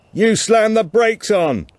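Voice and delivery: high, loud, slowed retort over the wind; shouted back. Acted. Martin answers Tilda over the wind after the crash, shouting back. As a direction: fling the blame back across the storm. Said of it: "he shouted back".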